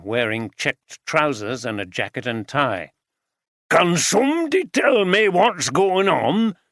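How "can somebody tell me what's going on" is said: higher, louder broad Yorkshire voice; an old Yorkshireman barges in, broad Yorkshire accent. A narrator's reading. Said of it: "he said in a broad Yorkshire accent".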